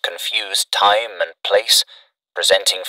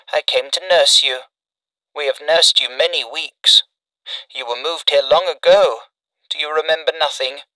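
lightly voiced mother, higher; a hint of a tender mother at the bedside; gentle mother; 7 semitones higher than usual. A narrator's reading.

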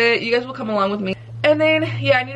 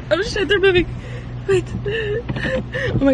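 startled cry; 10 semitones higher, slower than usual. Real, not acted. Car moving, seat reclined.

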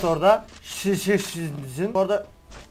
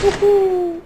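high excited hoot; 12 semitones higher, 6 dB louder than usual. Real, not acted. Reacting to a headshot.

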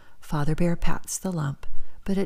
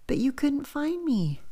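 high squeaky cub voice; squeaky little bear; 9 semitones higher, 4 dB quieter than usual. Acted. Little bear teasing father, squeaky and high. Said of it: "squeaks Little Bear".

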